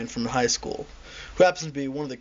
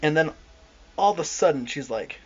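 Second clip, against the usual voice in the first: ordinary delivery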